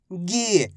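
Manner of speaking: गी with a long, held ee; drawn-out vowel. Shown as a demonstration. Teacher showing the long matra, गी drawn out. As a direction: hold the ee of गी, letting it fall. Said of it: "इसका उच्चारण खींच के".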